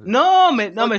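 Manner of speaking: sharp, high, loud objection; loud protest. Real, not acted. Cut off by the host, he protests loudly and high. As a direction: cry out in protest.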